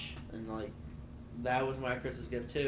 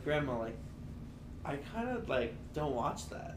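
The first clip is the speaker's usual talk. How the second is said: polite and soft; 8 dB quieter than usual. Retold or imitated. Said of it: "I didn't say it like that".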